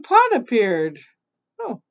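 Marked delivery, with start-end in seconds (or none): none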